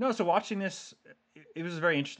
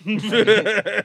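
loud burst of laughter; 11 semitones higher, 16 dB louder than usual. Real, not acted. Reacting to arguably jab.

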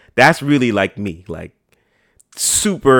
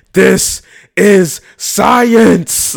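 slow, loud, higher epic roar over something trivial; mock Leonidas bellow; 8 semitones higher, 6 dB louder, much slower than usual. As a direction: shout it like a battle cry. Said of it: "it would be absolutely terrible".

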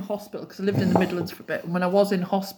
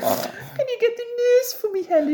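sing-song Midlands; 12 semitones higher than usual. Retold or imitated. Patient asking Helen for the nurse in a sing-song Midlands accent. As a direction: plead in a singy Midlands lilt. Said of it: "they're so singy".

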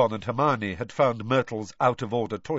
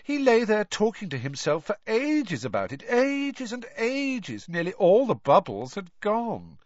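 put-on high, whiny girl ghost; Myrtle's ghost voice; 11 semitones higher, 4 dB louder than usual. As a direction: pitch up into a mopey schoolgirl ghost.

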